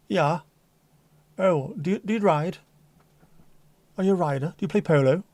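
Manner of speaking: a hint of posh polo set; posh caller. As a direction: play the horsey upper-class caller. Voicing a posh caller's questions.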